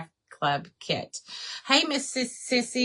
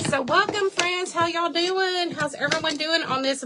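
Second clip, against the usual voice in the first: excited welcome; 8 semitones higher than usual. Real, not acted. Crafter greeting her live viewers, excited. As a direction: throw a bright hello out to everyone.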